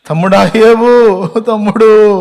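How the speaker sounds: loud, slow, high wail of grief; mock lament wail; wailing sisters. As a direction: cry out the brother's name in mourning. Sisters wailing over brother.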